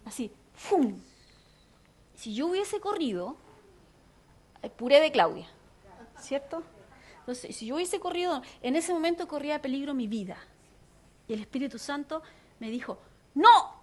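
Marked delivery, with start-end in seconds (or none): 0.5-1.0 quick whoosh of a passing car; imitated whoosh. Showing a car running the red light, whooshing noise. 13.4-13.8 short, loud, higher no; loud command. Retold or imitated. Holy Spirit warning her not to cross, loud command.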